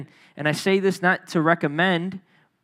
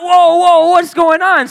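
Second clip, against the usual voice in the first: startled shout; 9 semitones higher, 9 dB louder, slower than usual. Retold or imitated. Seeing a pistol whipping.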